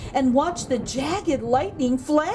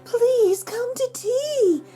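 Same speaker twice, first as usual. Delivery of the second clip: high, slow, calling out invitingly; lonely spider calling out an invitation; crying out; 12 semitones higher, slower than usual. A narrator's reading. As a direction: call sweetly to the bees. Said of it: "Miss Spider cried".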